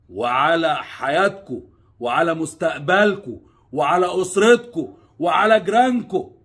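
slow loud build of warning. Real, not acted. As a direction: hammer home the danger, rising.

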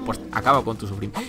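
ordinary talk

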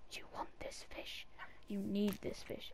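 breathy whisper coaxing the cat. Shown as a demonstration. Whispering the fish offer to the cat. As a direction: hush it like a secret offer.

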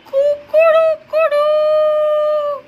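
long, high, loud rooster crow. Shown as a demonstration. Teacher crowing for the children like a rooster. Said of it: "by saying".

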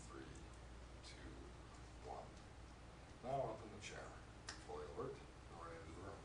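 soft, slow hypnotist trance voice; slow hypnotic voice. Shown as a demonstration. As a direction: lull them down gently and slowly. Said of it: "That was the slow-mo version".

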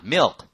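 "milk" with a dark L and a barely released k; careful milk. Shown as a demonstration. Teacher shows how to say milk properly. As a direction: drop the tongue back after the L in "milk". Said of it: "L 발음해서 띄질 마".

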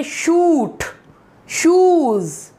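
शूट and शूज़ with a drawn-out oo; शूट, शूज़ said slowly, oo held long. Shown as a demonstration. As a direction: stretch the vowel in शूट, शूज़. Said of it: "अब बड़े उसमें जो है".

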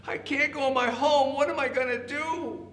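high-pitched, fretting little rabbit; distressed rabbit. A narrator's reading. Rabbit locked out of his home, high distressed voice. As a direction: wail helplessly in a high voice. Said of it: "the rabbit said".

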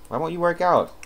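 loud, high nagging at a stubborn sim; exasperated scold. Real, not acted. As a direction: push the question in frustration. Scolding her lonely sim.

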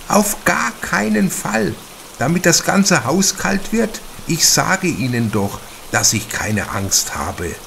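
a small, worn lady refusing help with airs; affected spinster. A narrator's reading. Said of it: "Aufgrund ihrer Stimme stellte Kaschudas sie sich klein und mager vor, ein wenig verbraucht, ein wenig affektiert".

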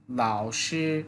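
老师: low dipping third tone, then level first tone; Chinese tones. Shown as a demonstration. Teacher models 老师 tones for students. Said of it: "third tone, পরের অংশ first tone".

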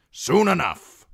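loud, high, gruff assurance. A narrator's reading. Lord answers the knight calling for death, gruff. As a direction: cut in forcefully and gruffly. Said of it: "Lord Nestor said gruffly".

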